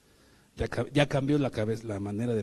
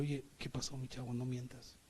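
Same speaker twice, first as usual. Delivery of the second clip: gentle correction; 2 semitones lower, 13 dB quieter than usual. Retold or imitated. Gentle correction of a lying friend.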